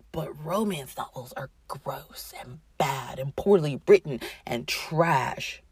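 sneering whisper, slowing down at the end; mocking whisper. Mocking the romance haters in a snide whisper. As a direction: hiss it like a snob's secret.

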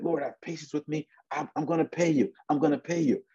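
high-pitched pleading; begging. Acted. Servant begging his lord for patience, pleading. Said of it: "he begged".

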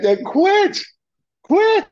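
high, loud "quit, quit"; urging high shout. Retold or imitated. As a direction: urge him to quit, loudly. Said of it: "I said".

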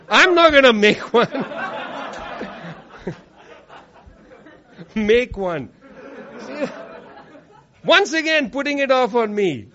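chuckling through the words; amused, laughing asides. Real, not acted. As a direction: talk while giggling at the crowd. Laughing banter with the audience.

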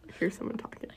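hushed whisper so nobody outside hears; real whisper. Real, not acted. Whispering to her co-host after hearing someone nearby. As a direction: drop to a breathy whisper, listening.

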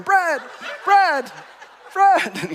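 very high, loud repeated calls; comic re-enacted shout after a fleeing man; calling after someone. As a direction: holler the name as you give chase. Chasing Brad, calling after him.

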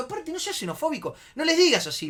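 high, rising to loud reproach; retold heated reprimand; indignant scolding. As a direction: tell a friend off, worked up. Scolding the shouting friend.

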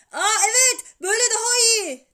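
high, loud voice on the phone; shouted approval. A narrator's reading. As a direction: yell back, satisfied. Shouted phone call continues.

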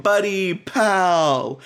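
overly chummy buddy voice; mock buddy greeting. Host's friend greeting his coworker, overly chummy. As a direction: slap him on the back with words. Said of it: "Brett's coming in here like".

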